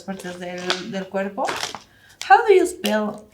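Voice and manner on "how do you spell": high, lifted "How do you spell?"; demo question. Shown as a demonstration. Teacher practicing with students, high demo question. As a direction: pitch it up as a model. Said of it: "una forma de practicar es esta".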